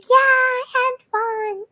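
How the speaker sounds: high, slow, put-on happy voice; quoting herself. Telling a friend she had fun, high and slow. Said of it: "And I was like".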